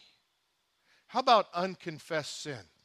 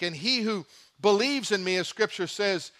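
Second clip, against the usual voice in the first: ordinary talk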